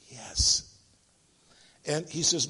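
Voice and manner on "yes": put-on whispered little-boy answer. Little boy answering about his daddy, whispering. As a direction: answer under your breath as a child. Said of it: "the little voice whispered".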